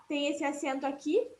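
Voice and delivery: usual speaking voice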